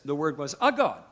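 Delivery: "a" punched loud before God; stress on A. Retold or imitated. Jehovah's Witness at the door arguing, "a" stressed loudly. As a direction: lean hard on the word "a". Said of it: "Jehovah's Witnesses come knocking at your door".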